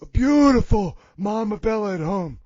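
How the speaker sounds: hammed-up confession in character; Big Johnny voice. Big Johnny confesses to the host, mock regretful character voice. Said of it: "but Big Johnny".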